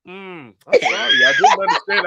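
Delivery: mock the machine in a squeal; mock phone system.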